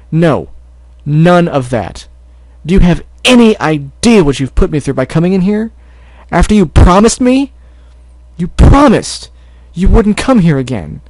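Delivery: furious brother shouting her down; angry shouting. Acted. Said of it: "kept shouting at her".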